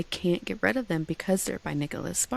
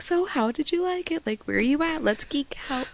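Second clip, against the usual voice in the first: much higher, eager and bubbly; excited mom; 9 semitones higher than usual. Retold or imitated. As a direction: gush like a fan ready to geek out. Mom eager to discuss book.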